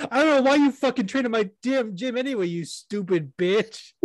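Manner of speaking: high-pitched exasperated mock insult, dragging the last words; mock hateful rant. Coach insulting his own fighter, mock hateful rant. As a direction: chew him out in a hammed-up high voice. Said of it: "do you just fucking hate Fernie Garcia".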